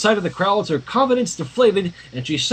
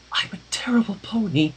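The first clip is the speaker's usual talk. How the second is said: softly whispered confession; whispered self-reproach; 3 semitones higher, 4 dB quieter than usual. Acted. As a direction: breathe it out in a whisper, ashamed. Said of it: "she whispered".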